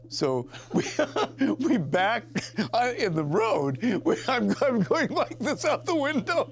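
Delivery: high-pitched, helpless laughter through the words; laughing. Real, not acted. Storyteller describes driving backwards out of Colgate, laughing. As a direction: barely get the story out for laughing.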